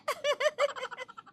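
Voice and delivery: high, soft mocking giggle; mocking laugh. Daughter-in-law laughs off the argument, mocking giggle. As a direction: end with a taunting laugh.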